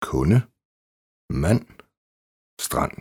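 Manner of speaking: kunde, mand, strand with only n for nd; silent D. Shown as a demonstration. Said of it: "N D". (stumt D i kunde, mand og strand)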